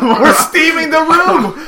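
excited. Acted. Gleeful triumph.

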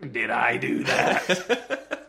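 slow, nasal-free mock innocent squeak; Urkel-style catchphrase. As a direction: drawl it out like a sitcom nerd. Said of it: "he looks at the camera and he goes".

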